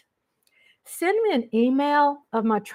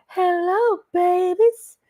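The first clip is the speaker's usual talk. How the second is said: higher, bright sing-song hello to the babies; high excited greeting; 8 semitones higher, slower than usual. Real, not acted. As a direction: coo it out to the viewers, up high. Greeting viewers sweetly.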